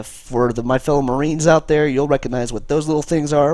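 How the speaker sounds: ordinary talk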